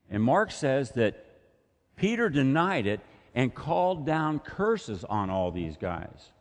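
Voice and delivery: ordinary delivery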